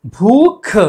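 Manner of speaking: भूख with forceful puffs on भ and ख; both sounds aspirated. Shown as a demonstration. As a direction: punch out "bhoo-kh" in भूख. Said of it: "दोनों भलाघात वाले हैं".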